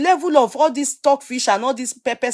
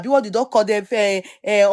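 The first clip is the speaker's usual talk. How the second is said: normal talking voice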